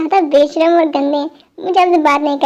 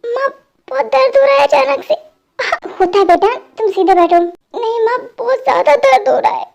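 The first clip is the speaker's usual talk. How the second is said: higher, strained voice in pain; 6 semitones higher than usual. Acted. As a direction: push the words through the pain. Sudden pain, taken to hospital.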